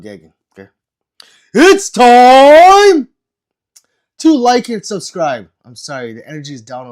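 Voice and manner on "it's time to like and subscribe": announcer hype. Pretend. Announces subscribe call.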